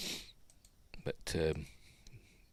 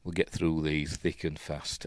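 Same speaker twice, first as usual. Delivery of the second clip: normal talking voice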